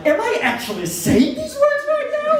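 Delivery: very high, stunned self-disbelief. Retold or imitated. Comic to the audience, reliving his own shock in a squeaky stunned voice. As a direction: squeak it like you can't believe your own mouth. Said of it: "my facial expression is like".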